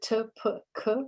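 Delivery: t, p, k as separate puffs of stopped air; voiceless stops. Shown as a demonstration. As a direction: demonstrate t, p, k one by one. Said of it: "what we call voiceless stops".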